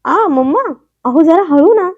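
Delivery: high, loud gasp then a breathless plea to go gently. A narrator's reading. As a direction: gasp out in sudden pain. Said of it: "तिच्या तोंडातून आवाज आला". (वेदनेने दबलेला उद्गार)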